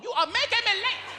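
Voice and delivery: very high-pitched nagging; imitated mama's complaint; complaining mother. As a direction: nag the kids in a high voice. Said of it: "She always talking about we making her late".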